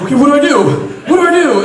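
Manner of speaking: frantic what do I do; panicked. Retold or imitated. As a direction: blurt it out in a frantic rush. Panicking after smelling soap.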